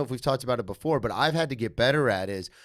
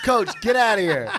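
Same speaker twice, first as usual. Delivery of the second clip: loud, higher, laughing protest; mock dismissive yell; 7 semitones higher, 6 dB louder than usual. Teammates throwing coach out, loud laughing mock yell. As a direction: shoo him off in a playful yell.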